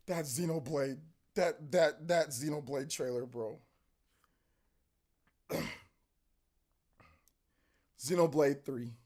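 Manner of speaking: real awe, almost muttered; stunned hush. Real, not acted. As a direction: speak it like you can't believe it. Overwhelmed after the trailer.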